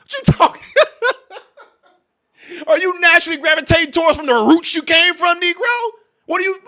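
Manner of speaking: very high, loud squeal breaking into laughter; cracking up while acting outraged; comic incredulity. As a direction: squeal the question in mock disbelief. Comic outburst after mimicking ancestral tongue.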